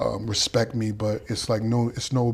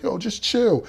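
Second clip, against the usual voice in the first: soothing; 10 semitones higher, slower than usual. Retold or imitated. Gotti's voice in the story.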